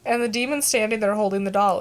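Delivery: ordinary delivery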